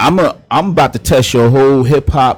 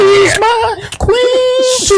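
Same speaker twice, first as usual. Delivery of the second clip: drawn-out high swoon; much slower than usual. Pretend. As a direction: croon it, stretched way up high. Swooning over caller, drawn out.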